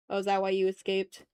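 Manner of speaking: usual speaking voice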